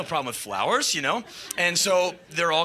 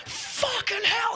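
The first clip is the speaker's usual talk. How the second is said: high, slow stunned voice; mock awestruck; 3 dB quieter, slower than usual. Crowd marvels at Iggy, high awestruck voice. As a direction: draw it out in squeaky disbelief.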